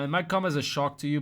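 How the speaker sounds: ordinary delivery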